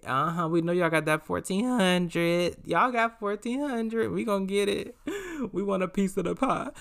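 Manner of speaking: high, drawn-out mock taunt; gloating scammer taunt. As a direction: tease in a high, greedy singsong.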